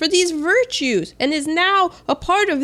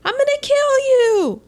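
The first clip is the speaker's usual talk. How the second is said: mock threat; 13 semitones higher than usual. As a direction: ham up the everyday threat. Casual threat acted out as example.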